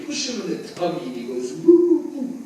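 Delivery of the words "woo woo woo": put-on high playful baby cooing sound. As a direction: coo like a bundled baby. Baby noise, performance opener.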